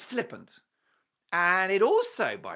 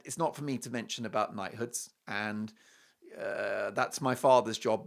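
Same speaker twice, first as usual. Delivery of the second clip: low, softer model answer; discreet; 8 semitones lower, 6 dB quieter than usual. Acted. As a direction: decline politely in a lowered, understated voice. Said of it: "He should have been discreet".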